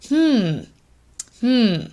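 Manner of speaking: high, musing hum; thoughtful hmm. Shown as a demonstration. Tutor shows a thinking response to the patient. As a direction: ponder what they said. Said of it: "you're thinking about it".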